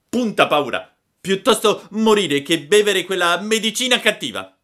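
put-on high, loud childish bravado; defiant puppet. A narrator's reading. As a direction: declare it like a stubborn little puppet. Puppet would rather die. (burattino spavaldo, voce acuta e forte)

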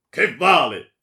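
very loud, high retort; furious dismissal. A narrator's reading. Baron unmoved by her lament, furious.

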